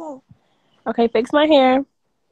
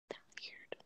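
breathy whisper on a single word; 34 dB quieter than usual. Real, not acted. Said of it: "I have to whisper".

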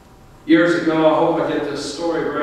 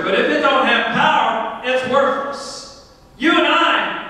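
raised-pitch, driving sermon delivery; impassioned preaching; 7 semitones higher than usual. Real, not acted. Pastor urging church to plug into God's power, impassioned preaching. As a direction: preach it with full conviction, pitched high.

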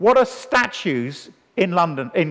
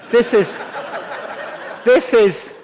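laughing emphasis; 5 semitones higher, slower than usual. Real, not acted. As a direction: laugh through the repeated this is. Laughing build-up to funeral joke.

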